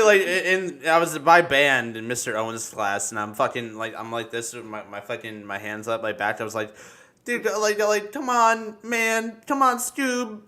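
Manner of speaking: normal talking voice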